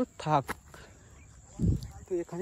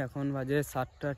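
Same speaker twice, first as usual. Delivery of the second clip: usual speaking voice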